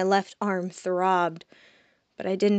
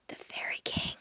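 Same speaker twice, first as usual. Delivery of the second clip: a breathless whisper, much softer; 11 dB quieter than usual. Acted. The demon secretly naming the Fairy King to the pixie, whispering. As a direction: barely breathe the name. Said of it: "she whispered".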